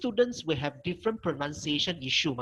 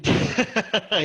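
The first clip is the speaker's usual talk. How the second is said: laugh; 5 semitones higher, 5 dB louder than usual. Real, not acted. Amused by student's question.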